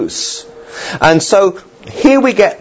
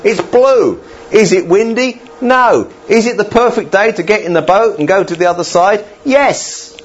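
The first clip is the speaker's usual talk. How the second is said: cheerful disciples; 8 semitones higher than usual. Retold or imitated. Voicing disciples checking weather.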